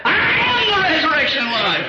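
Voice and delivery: high ringing proclamation of Christ's words. Acted. Preacher voicing Jesus to the church, high proclaiming. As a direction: declare it like Jesus himself.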